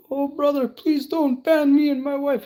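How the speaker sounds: high, loud pleading voice; pleading stranger voice. Retold or imitated. To the stranger on chat roulette, squeaky high begging. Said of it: "And they're like".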